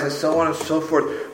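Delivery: ordinary talk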